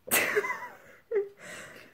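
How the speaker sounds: squealing high laugh; a giddy shriek of laughter; high giggle burst. Real, not acted.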